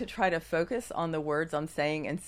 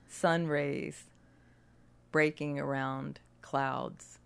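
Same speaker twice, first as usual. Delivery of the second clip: guided meditation. Acted. Leads a meditation.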